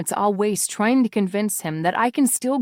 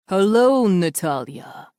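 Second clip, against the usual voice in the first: smooth, slow, louder greeting from a menacing man; silky masculine villain; 4 dB louder, slower than usual. A narrator's reading. Villain calling the heroine on her aunt's phone, silky and slow. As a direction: purr her name like a threat. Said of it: "silky smooth and deeply masculine".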